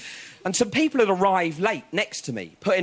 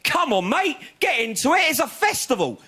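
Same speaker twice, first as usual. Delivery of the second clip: angry raver; 9 semitones higher than usual. Retold or imitated. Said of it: "he went".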